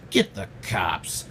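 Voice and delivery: low, slow put-on voice; Dave Chappelle-style impression. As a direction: imitate a slow, deep-voiced order. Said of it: "Just Dave Chappelle and shit".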